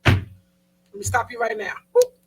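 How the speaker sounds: usual speaking voice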